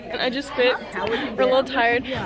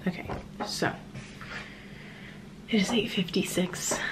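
soft late-night whisper; 7 dB quieter than usual. Real, not acted. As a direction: whisper so nobody wakes.

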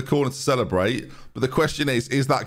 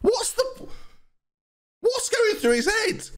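much higher, squeaky put-on voice; squeaky mock voice; slower than usual. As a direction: squeak the question out like a cartoon.

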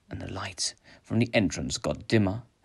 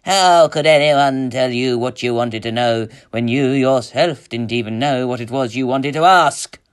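loud, mocking witch voice; an ancient enchantress scorning her victim; gloating enchantress; 4 semitones higher, 9 dB louder than usual. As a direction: gloat over the trapped hero.

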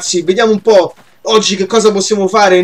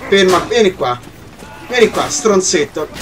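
ordinary talk, nothing marked

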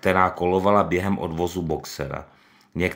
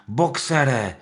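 higher, louder call; shouting; 7 semitones higher, 5 dB louder than usual. Acted. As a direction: call after the cart. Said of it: "křičela".

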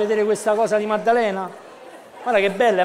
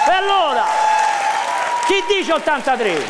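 auctioneer; 6 semitones higher than usual. Acted. Banditore d'asta.

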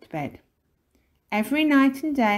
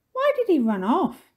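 put-on high, loud child's question; puzzled child; 10 semitones higher, 6 dB louder than usual. A narrator's reading. Minx wondering about the burglar, high and puzzled.

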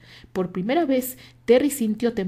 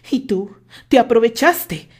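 louder, haughty challenge; a hint of arrogance; arrogant; 3 semitones higher, 10 dB louder than usual. A narrator's reading. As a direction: taunt him from above. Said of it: "dijo Terry arrogantemente".

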